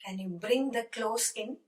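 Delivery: slow, careful English model sentence; slow teaching demo. Shown as a demonstration. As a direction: spell the sentence out slowly for learners. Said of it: "Can you കൊണ്ടുവരിക എന്ന് പറയാം".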